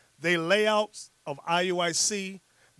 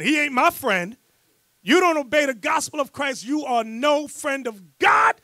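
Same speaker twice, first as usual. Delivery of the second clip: fiery preaching; 5 semitones higher, 6 dB louder than usual. Real, not acted. Preacher to the congregation about those who left, fiery denunciation. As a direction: condemn them with force. Preacher denounces former members.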